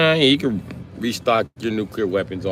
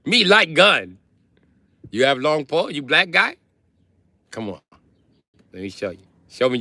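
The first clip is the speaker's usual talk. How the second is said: mock broken English; 4 semitones higher than usual.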